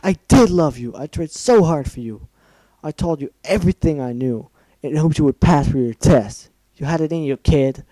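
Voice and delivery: loud, furious scream; screaming back. Acted. Mentor screaming back at the filly who failed her, furious scream. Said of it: "she screamed in return".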